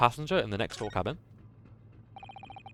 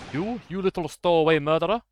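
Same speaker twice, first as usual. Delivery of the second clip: put-on botched Russian-accented sailor, louder and higher; sailor's sneer in a Russian accent that slips; failed Russian accent; 4 semitones higher, 6 dB louder than usual. A burly sailor sneers at the stowaway in a failed Russian accent. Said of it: "oh, no, I can't do an accent".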